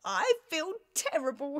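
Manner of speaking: a groaning, miserable king. Acted. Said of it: "he groaned".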